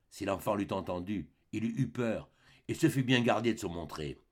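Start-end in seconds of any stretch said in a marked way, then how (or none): none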